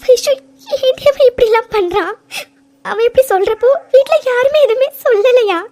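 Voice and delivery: tearful pleading, voice rising; crying out in distress at the news. Acted. Mithu cries to Vaishu about the fire walk.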